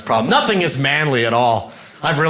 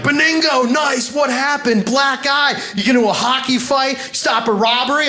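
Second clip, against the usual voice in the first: excited friends; 11 semitones higher than usual. Retold or imitated. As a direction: hype him up like buddies.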